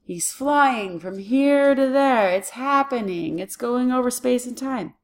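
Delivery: teacher acting out a long flight; showing ongoing progress. Shown as a demonstration. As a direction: draw the words out like motion over distance. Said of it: "Do you see the progress?".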